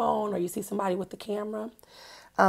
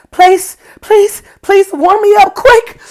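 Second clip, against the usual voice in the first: high, loud pleading with chattering voice; shivering plea; 9 semitones higher, 15 dB louder than usual. Acted. Teacher acting a chilly child, shivering plea. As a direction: beg to get warm, shivering. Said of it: "A shiver".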